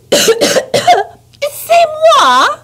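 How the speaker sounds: high, loud, French outraged question; outraged French wife, rising high; indignant French. Acted. Antoinette is blamed, outraged French question high and loud.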